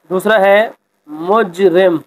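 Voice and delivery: ordinary talk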